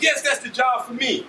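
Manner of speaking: high-pitched eager outburst, retold; excited. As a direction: act out being sold on it at once. Acts out his eager younger self.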